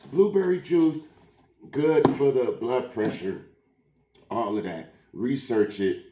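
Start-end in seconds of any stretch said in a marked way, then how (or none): none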